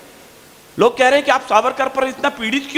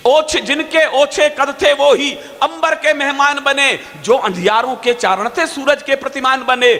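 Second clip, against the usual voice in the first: raised, rhythmic declamation; poetry recitation; 4 semitones higher, slower than usual. Acted. Poet condemning those who erased Savarkar, declamatory verse. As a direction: declaim each couplet, falling away on the last line.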